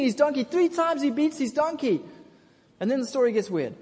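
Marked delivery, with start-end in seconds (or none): none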